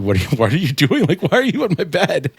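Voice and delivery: high, bewildered mock alarm; rising alarm at a roommate in the bed; retold alarmed questioning. Confused roommate woken at night.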